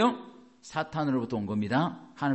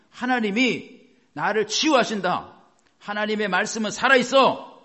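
bold declaration of faith; 6 semitones higher, 5 dB louder than usual. Shown as a demonstration. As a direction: proclaim it firmly, word by word. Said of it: "이렇게 붙들고 계속 그걸 주장하시고". (높고 크게 천천히 선포하듯)

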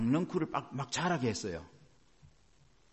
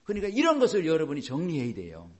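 ordinary delivery

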